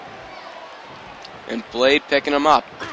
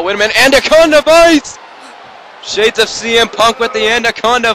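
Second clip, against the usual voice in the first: sudden high, loud excited shout; excited call; 8 semitones higher, 5 dB louder than usual. Real, not acted. Commentator calling a surprise hold to viewers, loud and thrilled. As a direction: erupt as the hold locks in.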